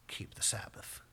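soft, breathy hush on the Sabbath; whispered command. Shown as a demonstration. God's last word after all the tasks, whispered. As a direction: let it fall to a whisper.

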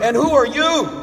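startled burglar. Acted. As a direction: demand it of the bird, high and loud. Burglar questions the parrot.

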